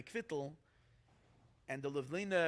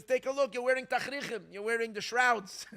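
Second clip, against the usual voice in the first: insistent Rebbe; 6 semitones higher, 6 dB louder than usual. Retold or imitated. Rebbe shows him his shrouds.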